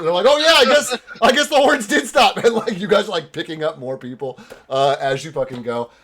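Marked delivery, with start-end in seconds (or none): 0.3-2.4 guard voice. Acted.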